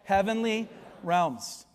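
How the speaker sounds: slow, deliberate "Heavenly realms"; leading repeat. Shown as a demonstration. Pastor leading the congregation to repeat, slow and deliberate. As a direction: say "Heavenly realms" slowly for the church to echo. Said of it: "Say those words with me".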